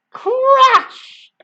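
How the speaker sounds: high, loud sound effect; crash. Acted. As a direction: boom it out. Sound effect read aloud.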